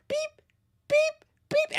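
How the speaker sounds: high, thin electronic beeps; high mock monitor beeps; heart monitor beeps.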